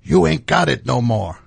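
lightly voiced tough-guy taunt; a hint of a boxing bully; Clubber Lang taunt.

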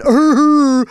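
loud, high mock-doofus holler; dumb, blaring "arrr" noise; doofus roar. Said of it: "like a doofus".

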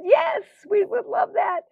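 high, delighted gushing yes; imitating the women's high-pitched delight; eager yes. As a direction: gush it like thrilled friends. Women eagerly accepting her offer.

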